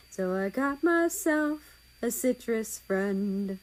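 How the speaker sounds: half-sing it slowly like a ballad; slow lyrical reading of song words; poem recital. A narrator's reading.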